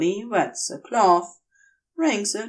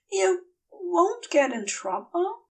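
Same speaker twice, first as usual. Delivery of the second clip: worried Aziraphale; 9 semitones higher than usual. Acted. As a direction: ask it small and worried. Aziraphale asks again.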